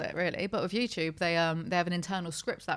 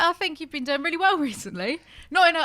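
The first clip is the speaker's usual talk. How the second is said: mildly patronizing praise, pitched high; unintentionally condescending; 8 semitones higher, 3 dB louder than usual. Real, not acted. Host praising comedian's recent work, accidentally condescending. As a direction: praise them a touch condescendingly, voice lifted. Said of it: "that sounded really condescending".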